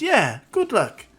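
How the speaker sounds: high, loud, drawn-out good wishes; cheery send-off. Acted. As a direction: cheer a friend on, slowly. Girl cheerfully wishes luck.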